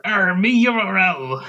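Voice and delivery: growly pirate "Arr" opener. Pretend. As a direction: ham it up like a pirate. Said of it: "You say it like a pirate".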